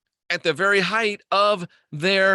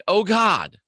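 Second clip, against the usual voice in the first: mock yell; 4 semitones higher, 3 dB louder than usual. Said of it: "and you're yelling".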